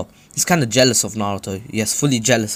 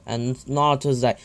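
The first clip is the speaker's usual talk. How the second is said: ordinary talk, nothing marked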